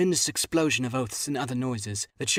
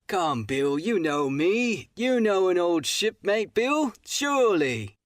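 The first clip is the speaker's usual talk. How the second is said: high, slow wheedling pirate; sly seaman drawing out each word, higher and louder; pleading old shipmate; 8 semitones higher, 4 dB louder, slower than usual. Acted. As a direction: coax him like an old friend, slowly.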